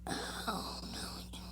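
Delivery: breathless whisper; mock-horrified whisper. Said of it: "people who live in California are probably like".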